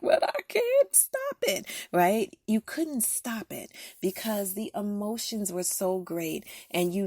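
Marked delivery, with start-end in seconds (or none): 0.0-1.6 giving in to tears. Retold or imitated. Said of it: "all of a sudden you were like".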